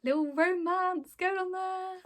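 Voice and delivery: playful high lilt; teasing. Real, not acted. Teasing about a budding romance.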